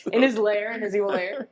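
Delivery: laughing through a joking aside; laughing mock villain lair. Real, not acted. Joking to her co-host about the creep's evil lair, laughing. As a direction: giggle the words out like a joke.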